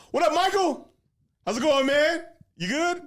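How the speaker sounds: high, loud, overly cheerful hello; exaggerated friendly greeting. Greeting the ex's new boyfriend Michael to annoy her, loud and high.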